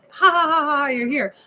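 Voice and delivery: loud, high fake laugh at the door; hammed-up cackle of welcome; mock laughing welcome. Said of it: "not meet the door like".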